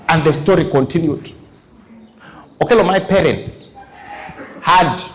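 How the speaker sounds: usual speaking voice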